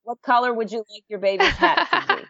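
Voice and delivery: high, giggly mock-sweet question; laughing through a sweet high question; laughing midwife voice. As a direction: tease as the knitting midwife. Playing the knitting midwife.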